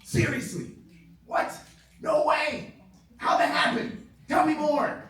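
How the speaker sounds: high, bubbly, laughing oh yeah yeahs; delighted phone chatter, high and laughing; eager phone listener. Shown as a demonstration. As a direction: gush like you're hooked on their story. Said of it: "I'm like".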